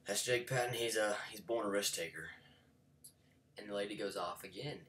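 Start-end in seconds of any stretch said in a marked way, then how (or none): 0.1-2.3 loud proud announcing; coach calling out. Retold or imitated. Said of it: "while he's saying".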